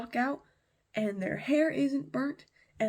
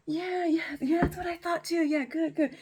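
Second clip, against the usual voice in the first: higher, louder rapid-fire agreeing; acted eager agreement; 7 semitones higher, 4 dB louder than usual. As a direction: play a king hurriedly confirming the answer. King hastily agrees with his men.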